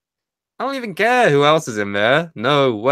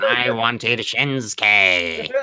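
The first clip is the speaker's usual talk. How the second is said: very slow alien whine; slow mock E T; 2 semitones lower, much slower than usual. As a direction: drawl it out like E T. E T voiced in joke.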